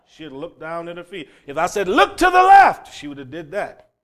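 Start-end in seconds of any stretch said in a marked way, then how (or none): 1.9-2.9 loud high called direction; shouted command. Retold or imitated. Directing Olivia from afar, a loud high shout. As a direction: call it out across the road.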